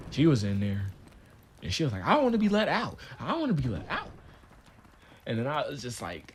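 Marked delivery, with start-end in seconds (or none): 2.1-4.1 high-pitched mimicked plea; pleading girl. Storyteller mimics the other girl held in there, pleading. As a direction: whine it in a raised mock-girl voice. Acting out detained girl's demand.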